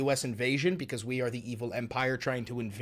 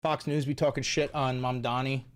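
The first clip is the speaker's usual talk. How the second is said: ordinary delivery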